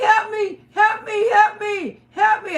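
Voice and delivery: high, loud repeated pleas; shouting help me from the truck; calling for help. Retold or imitated. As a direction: beg strangers to help, urgently. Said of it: "I say".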